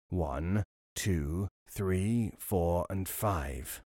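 low, soft, slow counting; robber counting. Acted. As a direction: count slowly and quietly like a robber. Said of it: "The counting continued".

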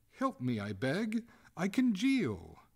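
high, loud, drawn-out wail; desperate, stretched-out plea; crying out. A narrator's reading. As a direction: slowly wail for help. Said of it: "cried the ghost".

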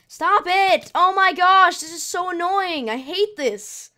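real angry outburst at the game; frustrated yell. Real, not acted. Player shouting at a mob that keeps attacking, furious.

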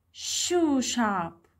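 "Shoe shop" with SHOE drawn out, shop falling; stress on SHOE. Shown as a demonstration. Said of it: "عايش أنا شددت".

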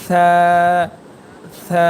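সা as a long, thin "thaa"; tongue tip at upper teeth edges for a lisped, held সা; thin letter sound. Shown as a demonstration. Teacher showing the thin letter, long lisped সা. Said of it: "এই সা হরফটি উচ্চারণ করবেন".

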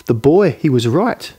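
usual speaking voice

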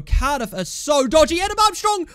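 desperate high yelling, building to full fury; frantic screaming. Real, not acted. Screaming at players to score.

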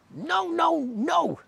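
high, slow incredulous little tadpole; squeaky tadpole, aghast at frogs. A narrator's reading. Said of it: "said the tadpole".

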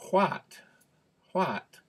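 WHAT with an open "ah" vowel, pitched high; exaggerated "whaht", as it is spelt; spelling pronunciation. Shown as a demonstration. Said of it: "you would expect that to be".

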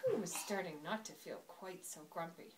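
usual speaking voice

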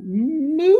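high, loud chick moo. A narrator's reading. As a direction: belt out a squeaky moo. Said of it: "it said loudly".

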